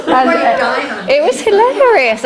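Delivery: high laughing voice. Real, not acted. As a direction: laugh through it. Laughing at own story.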